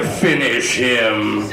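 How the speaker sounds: slow, low, menacing "Finish him"; Mortal Kombat announcer. Retold or imitated. Girlfriend mid-act says it like the game announcer, slow and menacing. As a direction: growl it like a fight game announcer. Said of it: "she's like".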